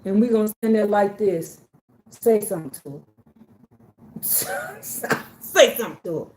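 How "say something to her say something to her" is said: mock threat, pitched low; low menacing dare. Backup standing behind Melissa daring listeners, mock menacing.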